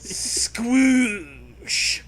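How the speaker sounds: loud wet squoosh noise; mock splat sound; squish sound effect. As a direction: make it sound gloppy. Sound effect read aloud.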